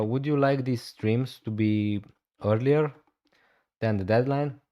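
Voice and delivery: ordinary delivery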